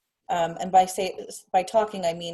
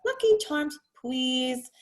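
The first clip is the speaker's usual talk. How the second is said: put-on slow sing-song child asking, "Lucky" jumping much higher; imitating the little boy; 4 semitones higher, much slower than usual. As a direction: echo the toddler's rising question tune. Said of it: "and he goes".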